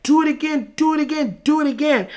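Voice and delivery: high excited pleading; eager begging. Retold or imitated. Begging in her head.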